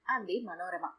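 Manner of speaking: ordinary delivery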